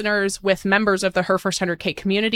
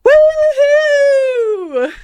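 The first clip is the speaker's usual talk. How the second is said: excited cheering; 10 semitones higher, 6 dB louder, much slower than usual. Real, not acted. Celebrating hundredth episode.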